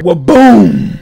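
loud, booming "wa-boom"; imitated blast with a long booming tail; explosion noise. Reader voices comic explosion, booming loudly. Reads explosion sound effect aloud.

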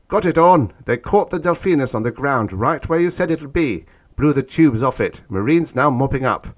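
high, loud, urgent dispatch; excited Stetson report. A narrator's reading. Stetson reporting victory to Orn, excited.

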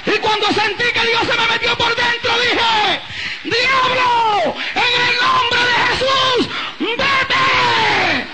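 high, drawn-out Pentecostal shouting; sustained high revival holler, stretched phrase endings; full-force preaching. Real, not acted. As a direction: cry out to God and command the devil at the top of your range.

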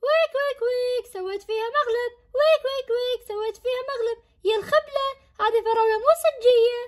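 very high mocking kid's sing-song; gloating taunt. Brother taunting his sister after the prank, high and mocking. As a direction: crow over the prank.

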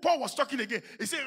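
normal talking voice

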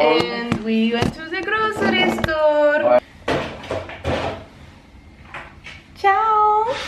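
put-on high, loud, slow Italian accent; sing-song fake Italian accent. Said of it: "I need to cut the Italian accent".

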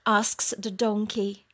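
ordinary delivery

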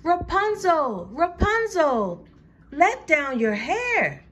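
high, slightly slow call; prince calling up. Acted. Prince calling up, unaware the witch waits, high and slow. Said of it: "heard the prince call out".

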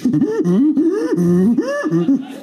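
loud, high monkey hoots; monkey miming drinking. Acted. Monkey telling the sergeant about drinking, high hooting. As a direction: hoot in bursts like a monkey. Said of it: "The monkey says".

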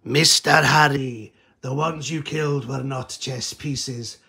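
slow, menacing villain read of a tweet; Bond villain voice. Retold or imitated. Reading the Taliban commander's tweet to Harry as a slow Bond villain. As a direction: sneer it out like a Bond-type villain. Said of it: "I don't know why I'm doing that voice".

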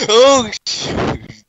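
startled shout. Real, not acted. Sudden danger.

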